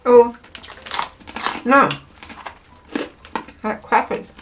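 chewing while talking; eating a snack; mouth full. Real, not acted. Seen 1: Woman bites and chews crackers 0.3–5.9; mouth clear by 6.4.

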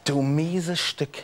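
usual speaking voice

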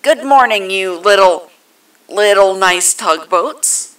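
loud megaphone greeting, slowing at the end; amplified, drawn-out announcer through a megaphone. A narrator's reading. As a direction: boom it out through a little megaphone. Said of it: "The megaphone spoke".

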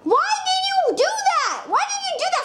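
high exasperated why. Real, not acted. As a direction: demand it, frustrated and high. Friend ruined the level.

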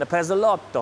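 Pezzolotto with mock Italian flair; Italian accent. Shown as a demonstration. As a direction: ham up Pezzolotto Italian style. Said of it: "in my Italian accent".

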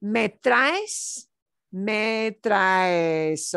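"traes" stretched into nearly three syllables. Shown as a demonstration. Teacher to class, stretching each syllable. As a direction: draw out "me traes" slowly. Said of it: "it's almost three syllables".

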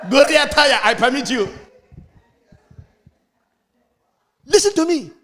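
high, loud joyful calls; excited calling out. Real, not acted. Preacher calling to church members, high and loud. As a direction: call out with delight across the church.

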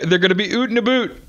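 exaggerated Canadian "oot" and "aboot"; mock Canadian accent. Teasing the Canadian guest in a mock Canadian accent. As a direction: ham up a Canadian accent for laughs. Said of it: "make fun of the way you say".

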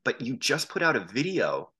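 mildly worked-up challenge, pitch climbing; retold indignant complaint. Upset bar-goer confronting the band member over a video, mock indignant. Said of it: "was all bent out of shape about this".

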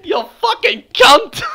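high, tearful hammed-up curse; mock furious cry. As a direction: wail the insult in mock fury. Mock meltdown over broken microphone.

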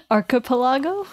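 archipelago as ar-kuh-puh-LAH-go, rising; tentative pronunciation. Shown as a demonstration. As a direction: ask archipelago with a short "kuh". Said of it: "Is that how you say".

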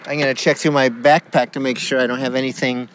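ordinary talk

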